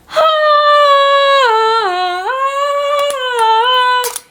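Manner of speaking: long high wailing cry; high crying wail at the memory. Real, not acted. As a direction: let out a sung, sobbing wail. Crying wail after knife story.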